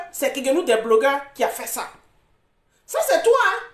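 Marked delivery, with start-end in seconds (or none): 2.8-3.6 sharp accusation. Real, not acted. Accusing the other woman.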